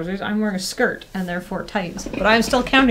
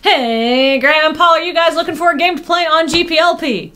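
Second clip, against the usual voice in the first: higher, louder pitchman sell; ad announcer voice; 8 semitones higher, 4 dB louder than usual. Pretend. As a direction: pitch it like a cheesy game commercial. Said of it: "I feel like this is an ad".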